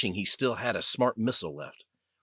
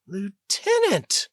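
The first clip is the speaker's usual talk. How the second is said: much higher, louder, slow drawn-out call; desperate call; 11 semitones higher, 4 dB louder, much slower than usual. Acted. Pilot waking beside her sergeant, calling the lieutenant in panic.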